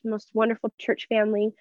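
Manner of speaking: ordinary delivery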